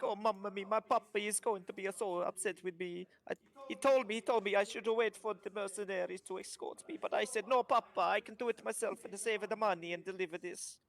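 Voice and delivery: lightly voiced kobold with an Italian lilt, fretting; worried little trader dreading his papa's anger; mock Italian kobold, mildly upset; kobold's fretful accent. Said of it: "He's like".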